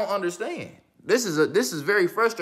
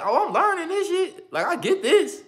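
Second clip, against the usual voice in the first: much higher, giddy and pleased with himself; imitating his own eager confidence; proud excitement; 10 semitones higher than usual. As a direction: crow that you finally get it.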